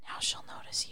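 whispered close to her ear. Retold or imitated. Friend retelling a movie scene, whispering. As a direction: lean in and whisper it softly. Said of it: "he leaned in and he goes".

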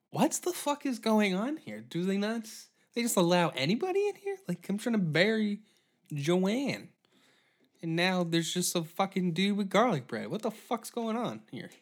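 baffled fury at the intruder; outraged mourner. Pretend. Mourner burying Joanne, outraged at garlic bread guy.

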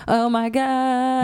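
overwhelmed at how big the new job feels; mock overwhelmed gasp. Drawn-out overwhelmed gasp about the new job. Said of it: "it's also like".

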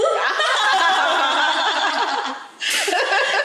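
laughing. Real, not acted. Laughing at flirt line.

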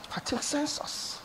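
whispered repeat. Shown as a demonstration. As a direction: drop it to a breath. Preacher repeats phrase, second hushed.

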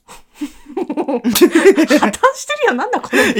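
high laughing break; losing it mid-sentence. Real, not acted. Host breaks character laughing.

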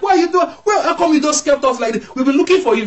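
high-pitched, exasperated scolding in imitation; reprimanding parents. Mary and Joseph reprimand young Jesus after searching, high exasperated scolding. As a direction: act out a worried parent telling off a child. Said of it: "they reprimanded him".

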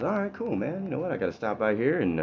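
pumped, easygoing street guy; cheerful poor man. Acted. Poor man to his new friend, cheerfully upbeat. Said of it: "The poor man goes".

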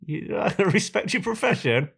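high, sneering mock voice; mocking retort. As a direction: taunt her in a put-on high voice. Retelling his own jibe.

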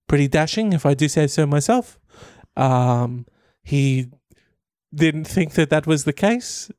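wobbly put-on New Zealand accent; earnest mock statement in a Kiwi accent. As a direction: play a Kiwi teacher giving testimony. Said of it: "I'm doing the accent".

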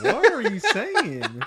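baffled disbelief. Retold or imitated. Baffled by kid's British words.

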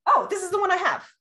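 high, fast, gushing delight; excited squeal. Real, not acted. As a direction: squeal with sudden joy at finding it. Excited discovery of her gate.

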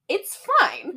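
high-pitched mock dismissal; breezy reassurance. Bailey plays down taking the car, high mock reassurance. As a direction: imitate someone insisting all is well. Friend brushing off stolen car.

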